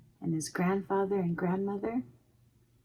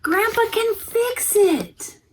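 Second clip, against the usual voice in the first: put-on very high, louder, slow eager child; a boy sure grandpa can fix it; child's voice; 14 semitones higher, 6 dB louder, slower than usual. A narrator's reading. Joseph insisting to his mom, high slow and eager. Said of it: "Joseph said".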